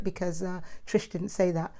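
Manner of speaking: ordinary talk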